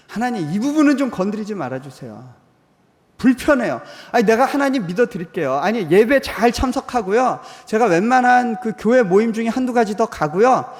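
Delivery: loud, high pleading prayer to God; pleading believer. Pretend. Believer pleading loudly with God to keep out.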